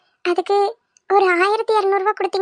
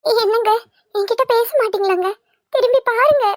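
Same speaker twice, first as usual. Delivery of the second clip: high, wheedling plea; plaintive coaxing; 5 semitones higher than usual. Acted. Wife begging husband to turn round, plaintive coaxing. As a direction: plead with him in a raised pitch.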